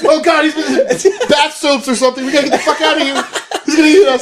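high, laughing mock fright; mock panic. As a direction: squeal like scared kids fleeing. Voicing scared teens, high.

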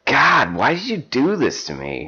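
high, melodramatic lament; anguished reproach. Acted. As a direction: wail it at your lover, acted. Acted anguished reproach to lover.

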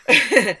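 short, loud burst of laughter. Real, not acted. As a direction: crack up briefly. Laughing at the retold line.

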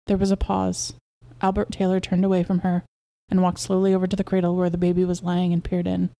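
ordinary delivery, nothing marked

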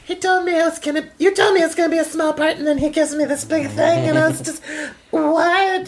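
high, breathless airhead voice; ditzy giggly airhead. Retold or imitated. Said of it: "I can't keep this accent up for that long".

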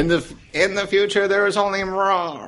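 mock grand proclamation. Mock grand quote, higher.

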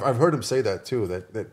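ordinary delivery

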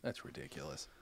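shocked whisper. Real, not acted. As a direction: breathe it out in disbelief. Shocked reaction.

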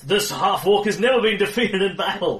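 loud, high boastful battle cry; proud shout. Acted. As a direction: bellow the boast at the enemy. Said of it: "He shouts".

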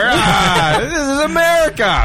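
mock yokel fairgoer. Mocking confused fairgoers.

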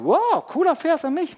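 high, loud gushing quote; mock delight. Preacher mimicking someone reading the morning verse, mock delight. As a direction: imitate an over-eager reader thrilled by a find.

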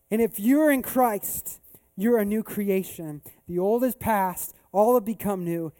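slow, loud, weighty declarations; impassioned pulpit delivery with long pauses; emphatic preaching. Real, not acted. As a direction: proclaim it to the congregation phrase by phrase. Preaches to congregation at full force.

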